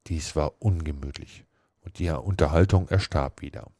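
ordinary delivery

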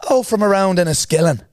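high, loud mock Irish answer; put-on Irish accent. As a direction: bluff an Irish hometown accent. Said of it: "So I said".

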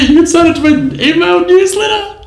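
very high comic voice; squeaky mock plug. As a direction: pitch way up for a silly sales plug. Giddy mock plug over laughter.

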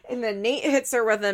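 normal talking voice

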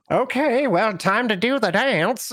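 DM hams up Zoran before his dance; Zoran's voice. Retold or imitated. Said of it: "Zoran is like".

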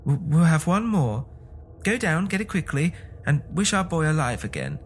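high-pitched, urgent excitement; excited cry. A narrator's reading. Wife begging husband to wish son alive, excitedly. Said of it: "she cried excitedly".

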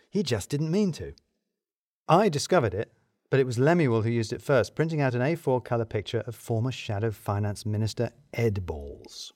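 ordinary delivery, nothing marked

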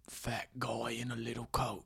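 soft British take on a movie quote; sing-out line kept hushed, in British accent; quiet British star doing a bit; Jason Statham. Acted. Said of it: "whisper it a little bit".

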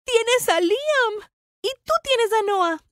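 high, slow, drawn-out protest; heated argument. Acted. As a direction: throw it back, stretching each word. Pelea a gritos entre hermanas. (discusión acalorada, voz aguda y alargada)

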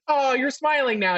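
high, lightly acted cashier voice; mock delighted customer service. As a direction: chirp it like a commercial cashier. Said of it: "the cashier is like".

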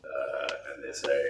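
ordinary delivery

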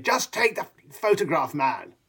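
imitating the Duke's curt bluntness; Prince Philip. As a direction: toss it off brusquely like an old royal. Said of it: "Prince Philip going".

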